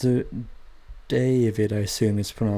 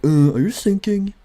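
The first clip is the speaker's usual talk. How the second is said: higher, louder mock worried radio call; imitating radio operator; 9 semitones higher, 7 dB louder than usual. Operator radioing the sinking ship, mock worried. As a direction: ham up a nervous operator checking in. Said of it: "They were like".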